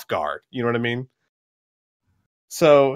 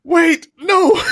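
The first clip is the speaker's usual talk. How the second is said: high, loud cartoonish protest; hammed-up panicked yell; alarmed shout; 9 dB louder than usual. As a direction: cry out to stop an attacker. Cyborg pleads as Indigo attacks.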